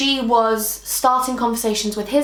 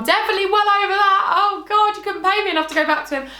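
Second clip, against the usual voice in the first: high, quick squeal of denial; pitched way up, insisting; high-pitched protest; 9 semitones higher, faster than usual. Real, not acted. Insists she is over her ex.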